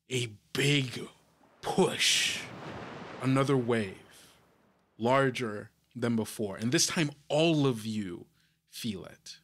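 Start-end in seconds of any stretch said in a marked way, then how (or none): none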